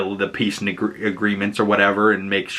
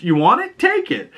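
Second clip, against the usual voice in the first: mock taunting Hal; 14 semitones higher than usual. Hal offers the ring, taunting.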